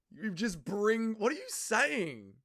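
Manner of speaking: high, slow, drawn-out disbelief; slow bewilderment. Real, not acted. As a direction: stretch each word in confusion.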